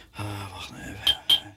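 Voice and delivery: nervous and hushed, pausing. Real, not acted. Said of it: "ik ben zo nerveus". (zenuwachtig, zacht en aarzelend)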